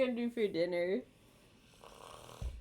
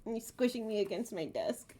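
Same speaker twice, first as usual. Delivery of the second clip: normal talking voice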